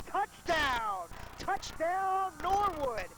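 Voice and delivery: shouting touchdown call, high and loud. Real, not acted. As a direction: call the score at full voice.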